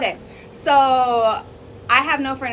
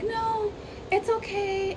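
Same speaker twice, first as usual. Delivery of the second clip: higher, slow and soft imitation of a shy woman saying no; soft-spoken decline; 9 semitones higher, slower than usual. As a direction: draw it out softly and politely, small voice. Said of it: "So she was like".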